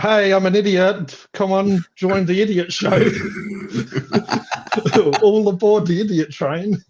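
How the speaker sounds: mock carnival barker for the idiot show; mock idiot host. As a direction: sell yourself as a buffoon, laughing. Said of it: "if you're like".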